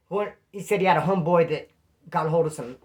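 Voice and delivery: normal talking voice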